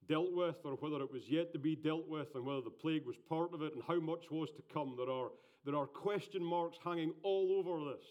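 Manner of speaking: loud, fervent sermon delivery; preaching. Acted. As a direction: preach it with full force. Preaching at full force.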